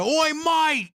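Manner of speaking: high, loud mock cockney lad yelling Oi mate; British bloke impression. Mocking a British man who corrected him in the store, high loud cockney. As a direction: ham up a shouting British geezer. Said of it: "another British person that came over there".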